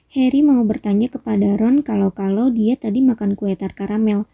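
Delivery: plain reading voice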